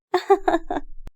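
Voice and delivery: high, light giggle; playful giggle. A narrator's reading. Daughter amused by her mother's protest, giggling. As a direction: giggle off the mild scolding.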